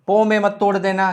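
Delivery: ordinary talk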